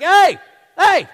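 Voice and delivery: high, loud hail across a valley; David hailing Saul from the mountaintop, loud and high; calling out far. Acted. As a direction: shout to someone far off.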